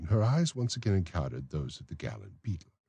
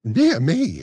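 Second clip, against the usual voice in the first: higher, louder startled exclamation; exclaiming; 11 semitones higher, 10 dB louder than usual. Acted. Matron surprised by Bumble's principle, loud exclamation. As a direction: blurt it out in surprise. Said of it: "exclaimed Missus Corney".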